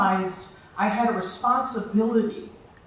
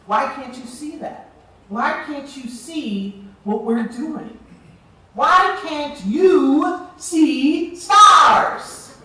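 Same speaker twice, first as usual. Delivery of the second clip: building to a loud, slow, high "Why can't you see stars"; frustrated pleading that swells into a loud appeal; rising plea; 4 semitones higher, 6 dB louder, much slower than usual. Real, not acted. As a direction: plead harder with each question. Passionate plea for her program.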